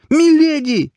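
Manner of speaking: very high, loud anguished wail; desperate, grief-stricken scream of her name; pained cry. A narrator's reading. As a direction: cry out in heartbreak at the top of the voice. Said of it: "Боль в голосе сталкера резанула по сердцу".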